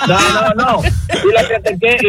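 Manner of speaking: laughing it off after the teasing. Real, not acted. Guest teased by the host for rambling, laughing. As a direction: chuckle through the protest. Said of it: "¿tú puedes terminar este cuento antes de que se acabe el año?".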